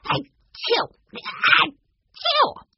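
two high-pitched pretend sneezes; acted sneezes. A narrator's reading. Benny sneezes twice at home, high acted achoos. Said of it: "He sneezed twice".

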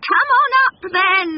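loud, high sly invitation. Acted. Cockerel slyly inviting the fox up. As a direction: lure him in with fake welcome. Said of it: "he said slyly".

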